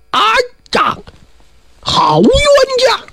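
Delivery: a high, drawn-out wail of despair; stretched high lament, almost sobbing; wailing cry. Acted. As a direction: cry out long and high, heartbroken. Stunned father wails at son.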